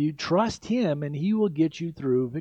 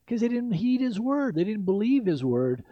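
ordinary talk

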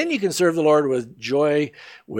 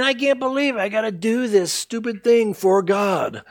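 a light mock grumble; whiny under-the-breath complaint, lightly played; grumbling volunteer; 6 semitones higher than usual. Preacher mimics a complaining volunteer, lightly whiny. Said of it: "they grumble and complain".

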